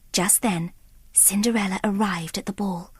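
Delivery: whispered story voice; hushed narration. A narrator's reading. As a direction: draw listeners in with a whisper.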